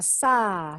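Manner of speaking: "sa" as the open first vowel of summer, drawn out; first syllable alone. Shown as a demonstration. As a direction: isolate "sa" from summer and hold it. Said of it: "La primera sílaba". (sa, primera sílaba de summer, sola y alargada)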